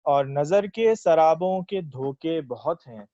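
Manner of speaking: slow, measured verse delivery; ghazal recitation. Acted. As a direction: recite it like a poet at a mushaira. Recites own ghazal at mushaira.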